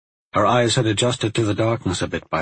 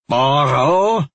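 loud, raised, indignant echo; angry growl; 6 semitones higher, 6 dB louder than usual. A narrator's reading. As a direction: throw the word back angrily. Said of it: "the woman growled angrily".

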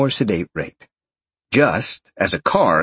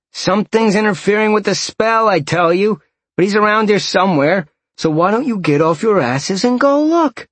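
higher, agitated retort; annoyed; 10 semitones higher, 3 dB louder than usual. A narrator's reading. Tracker defending his spell to the others, annoyed. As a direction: argue back, pitched up and testy.

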